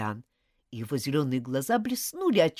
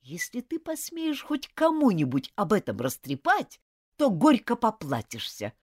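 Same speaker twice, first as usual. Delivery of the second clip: through gritted teeth; 7 semitones higher, 4 dB louder than usual. Acted. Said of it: "процедил сквозь зубы Джулиан".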